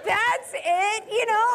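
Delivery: can't believe that's all it was; incredulous. Retold or imitated. Wife amazed the problem is only pillows, high incredulous voice. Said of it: "And I'm like".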